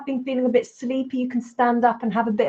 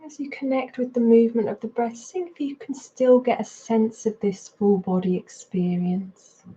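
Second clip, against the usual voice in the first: unhurried meditation guidance with long pauses; guided meditation. Real, not acted. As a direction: guide them into body and breath, leaving space between phrases. Leads a mindfulness practice.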